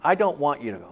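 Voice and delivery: ordinary talk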